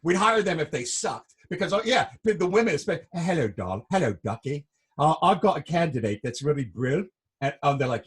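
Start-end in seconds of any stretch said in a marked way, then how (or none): 3.1-7.1 imitated British recruiter; British accent. Imitating UK women recruiters greeting a candidate, British accent. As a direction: put on a chummy British accent. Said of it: "people that came from the U K to be recruiters".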